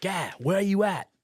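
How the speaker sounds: high, loud call mimicking his friend; urgent phone yell. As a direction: bellow it like a pal on the phone.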